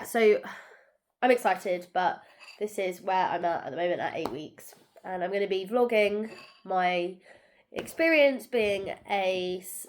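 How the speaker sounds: normal talking voice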